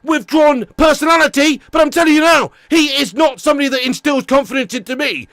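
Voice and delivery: shouting, swearing at the manager, fast and loud; furious rant. Real, not acted. Football fan ranting to viewers about manager, furious shout. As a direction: rage at the team's failings, full volume. Angry rant after England match.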